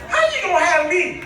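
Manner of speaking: usual speaking voice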